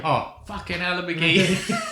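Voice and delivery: a hammed-up Scottish "fucking hell"; Scottish accent. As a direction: do a put-on Glaswegian exclamation. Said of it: "He says in the Scottish accent".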